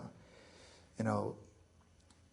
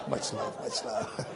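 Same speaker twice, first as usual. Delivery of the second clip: laughing; 3 semitones higher, slower than usual. Real, not acted. Laughing apology after joke.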